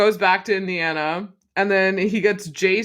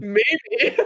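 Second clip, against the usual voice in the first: laughing mimic; 6 semitones higher than usual. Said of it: "You're like".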